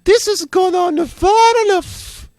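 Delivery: very high, loud, slow villain voice; booming level voice. Acted. Level speaks to player in slow loud villain voice. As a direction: boom it like a menacing castle level. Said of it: "Bowser's like".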